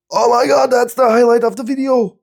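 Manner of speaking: high-pitched, thrilled marvelling; squeaky, wowed outburst; amazed excitement. Real, not acted. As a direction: exclaim in delighted awe. Amazed by protector sticking.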